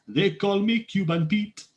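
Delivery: put-on lilting parody Cuban accent; Cuban Pete accent. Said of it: "It's the same fucking accent".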